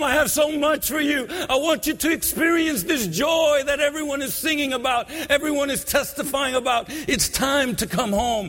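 impassioned revival preacher pleading with listeners; preaching at full force. Acted. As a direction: build the altar call with fervour. Preacher rising into altar call.

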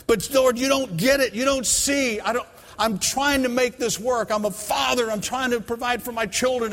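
high, pleading yelled protest. Retold or imitated. Father protesting to the Lord in his yard, high and pleading. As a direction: argue desperately with God. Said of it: "yelling out in the middle of my front yard as loud as I can".

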